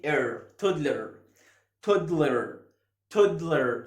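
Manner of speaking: تودلر with a clear er sound at the end; modeling toddler. Shown as a demonstration. Teacher repeating تودلر for the class to copy. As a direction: say تودلر ending on a firm er. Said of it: "الاي ار بتعمل ايه".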